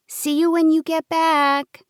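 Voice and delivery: put-on high, slow childlike voice; little girl voice. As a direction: speak like a small child.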